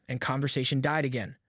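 plain reading voice

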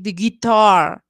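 the guitar said slowly, the A barely sounding; guitar, A swallowed, slow; lazy A, slow. Shown as a demonstration. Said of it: "Casi que ni suena".

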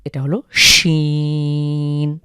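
sh clear at the start of শিন; Arabic shin. Shown as a demonstration. Teacher contrasting শিন with সিন for viewers. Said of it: "এখানে হলো সিন স্বাভাবিক".

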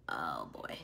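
whispered under breath; whispered aside. Real, not acted. Reacting while reading comments.

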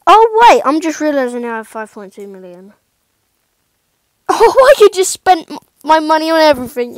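light up at the discovery; sudden excitement. Real, not acted. Realising he has millions.

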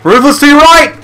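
urgent warning yell. Real, not acted. Warning teammate of enemy.

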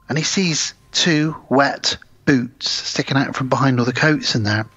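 ordinary talk

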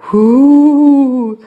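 long spooky wind noise; imitated wind howl. A narrator's reading.

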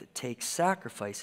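ordinary talk

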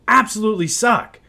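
fed-up fan snapping about his team; frustrated outburst. Real, not acted. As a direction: spit it out in real frustration.

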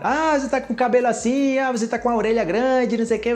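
high, fast taunting voice; teasing mockery. Someone teasing a friend about hair and ears, high mocking taunt.

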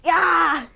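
loud, high drawn-out scream; frustrated yell. Real, not acted. Gamer losing the game winning kill, frustrated yell. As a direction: cry out mid-sentence in frustration.

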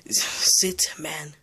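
normal talking voice

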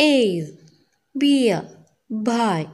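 A, beer, buy spoken one by one with full vowel glides; diphthong examples. Shown as a demonstration. Teacher giving examples of word patterns, careful separated words. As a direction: sound out A, beer, buy, a clear pause after each. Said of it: "Here is an example".

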